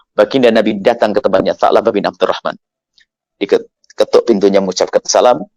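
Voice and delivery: ordinary talk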